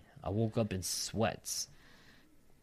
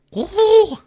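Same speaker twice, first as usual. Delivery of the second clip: high, loud "woo-hoo" whoop; loud falsetto-high hoot; 18 dB louder than usual. Real, not acted. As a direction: let out a sudden high whoop. Sudden whoop during the reading.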